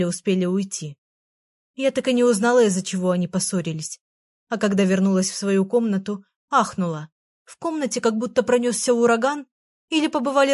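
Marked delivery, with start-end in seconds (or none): none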